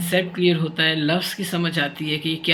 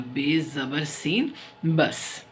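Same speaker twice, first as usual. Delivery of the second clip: slow spelling aloud; much slower than usual. Shown as a demonstration. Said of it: "spelling out letter by letter".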